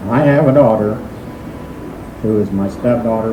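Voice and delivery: ordinary talk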